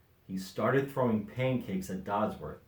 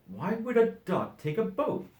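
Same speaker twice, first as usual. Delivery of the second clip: higher, puzzled musing; puzzled wondering; 11 semitones higher than usual. A narrator's reading. Dodsworth wondering at the duck on a boat, higher puzzled. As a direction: wonder aloud. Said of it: "he wondered".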